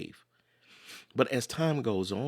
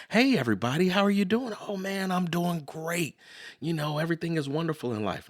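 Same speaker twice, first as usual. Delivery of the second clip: higher, louder, all-is-great hello; cheery greeting; 8 semitones higher, 4 dB louder than usual. Retold or imitated. Running into friends, faking that everything's great, in an upbeat voice. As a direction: act the picture-perfect friend who says life's wonderful. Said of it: "when you run into your friends".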